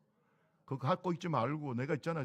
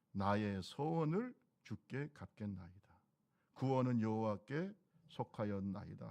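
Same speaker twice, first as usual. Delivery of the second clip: scripture reading; 4 semitones lower than usual. Acted. Reads scripture aloud.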